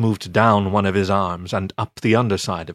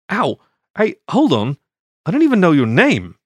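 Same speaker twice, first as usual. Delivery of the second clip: higher, louder yelp of protest; protesting shout; 7 semitones higher, 4 dB louder than usual. Acted. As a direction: shout after him while being dragged. Dragged away, protesting.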